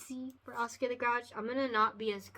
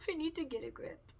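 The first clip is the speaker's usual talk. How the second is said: giggling through the line, a bit higher; laughing at herself; 6 semitones higher, 6 dB quieter than usual. Real, not acted. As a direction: laugh it off, pull yourself together. Laughing at own emotion.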